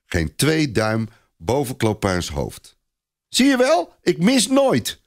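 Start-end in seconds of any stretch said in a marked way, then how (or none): 3.3-5.0 put-on a little boy crowing about his aim; proud boy. A narrator's reading. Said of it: "zegt kleine Robert trots".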